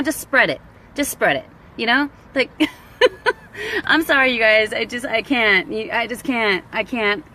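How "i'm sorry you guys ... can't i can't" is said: ordinary talk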